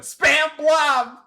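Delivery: loud, high sneering jeer; mocking shout. Streamer mocking chat's spam accusation, loud. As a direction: throw the accusation back, jeering.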